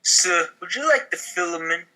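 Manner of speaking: normal talking voice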